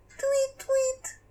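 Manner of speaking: very high chirpy bird voice; bird tweet. Acted. Animals answer in the fog.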